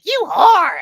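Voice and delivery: high, loud imitation of a scandalised mother; hammed-up mother's shriek; mock mom outrage. Retold mom's reaction.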